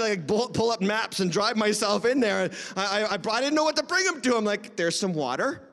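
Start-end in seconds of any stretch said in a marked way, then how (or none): 4.7-5.6 halting, low, unsure offers; mock uncertainty. Host stuck for sights to show his friend, mock uncertainty. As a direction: grope for each item with long pauses.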